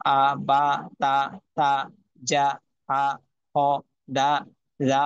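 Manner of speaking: each syllable punched out alone, with a gap; separate syllable demo. Shown as a demonstration. Teacher showing how to pronounce them, syllable by syllable. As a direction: sound out the letters one at a time, firmly. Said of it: "এরকম ভাবে উচ্চারণগুলা".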